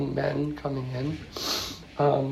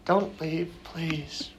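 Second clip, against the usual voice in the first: quiet, heartfelt pleading; soft retold plea; 7 dB quieter, much slower than usual. Echoing the old parishioners begging their priest to stay, softly. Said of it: "from their heart".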